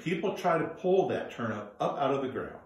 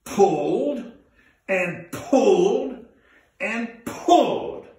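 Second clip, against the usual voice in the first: louder, slow drawn-out pulls; straining pull; 6 semitones higher, 7 dB louder, much slower than usual. A narrator's reading. Narrator acting out grandfather's tugging. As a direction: strain on every pulled.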